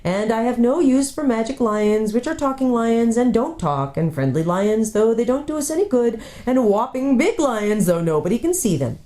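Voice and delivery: a sceptical dwarf voice, dismissive; scoffing dwarf. Acted. Dwarf scoffing at the children's talk of lions, grumbling.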